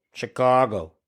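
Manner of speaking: Chicago-accented pronunciation of the city. Shown as a demonstration. Host shows his one Chicago-voice word, in Chicago accent. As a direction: say Chicago like a local. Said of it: "only word I do in Chicago voice".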